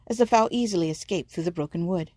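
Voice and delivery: plain reading voice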